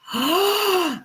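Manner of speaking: sharp high gasp of realisation. Real, not acted. Reacting to his texts with a high gasp. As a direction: gasp as it clicks.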